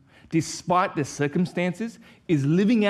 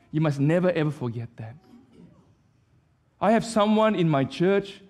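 ordinary talk

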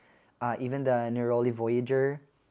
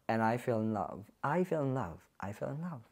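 fading to a whisper; 2 semitones lower, 6 dB quieter than usual. Real, not acted. Repeats, fading to nothing.

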